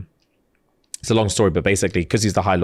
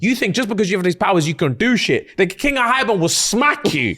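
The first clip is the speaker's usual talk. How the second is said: patronising lecture; 10 semitones higher than usual. Retold or imitated. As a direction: belittle her, half laughing. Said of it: "and he's like".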